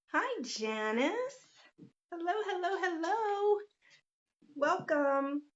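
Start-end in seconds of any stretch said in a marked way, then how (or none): 2.1-3.6 high, lilting hellos to the chat; singsong hello. Real, not acted. Greeting viewers joining the live, singsong. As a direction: sing out the greeting, pitched up.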